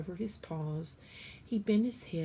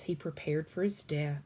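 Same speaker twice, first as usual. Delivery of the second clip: ordinary delivery, nothing marked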